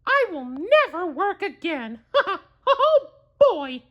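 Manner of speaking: high cartoon mouse voice with a gleeful laugh; Mickey laughing. Mickey delighted that magic is easy, laughing high. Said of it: "he cried".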